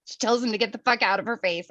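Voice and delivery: usual speaking voice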